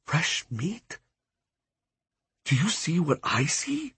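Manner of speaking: hushed, breathy whisper to a friend. A narrator's reading. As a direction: whisper it so no one overhears. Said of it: "he whispered to me".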